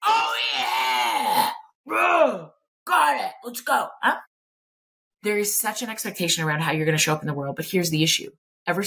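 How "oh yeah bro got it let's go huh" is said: mock bro cheer. Guests at a gender reveal seeing blue smoke, mock bro cheer. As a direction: whoop like a hunting buddy.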